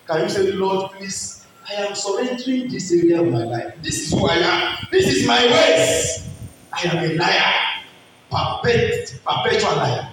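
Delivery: rising preacher's plea; fervent preaching. Acted. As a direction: push the congregation to pray. Altar call, rising intensity.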